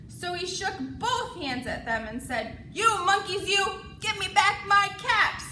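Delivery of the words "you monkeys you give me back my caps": loud angry demand. Acted. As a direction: demand them back. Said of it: "This made the peddler angry".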